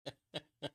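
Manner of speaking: short dry run of chuckles. Real, not acted. Host amused by his own remark, chuckling. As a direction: chuckle briefly to yourself.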